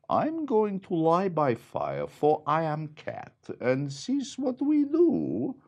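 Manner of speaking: high cat voice, slowing at the end. Acted. The cat claims the fire in a high voice. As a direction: purr it out like a smug cat. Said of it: "The cat said".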